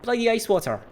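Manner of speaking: snapped out loud and high; angry outburst. Acted. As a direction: bark it out in frustration. Crewman angry about cleaning hands.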